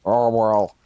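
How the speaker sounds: put-on voice of a debate candidate; Jim Clangley. Candidate in a debate on fried chicken seasoning, put-on folksy voice. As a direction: play a folksy candidate giving a debate answer. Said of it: "Jim Clangley was just like".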